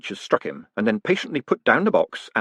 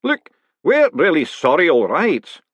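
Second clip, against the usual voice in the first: worshipful master; 6 semitones higher, 5 dB louder, slower than usual. A narrator's reading. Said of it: "whose voice suggested to Moist".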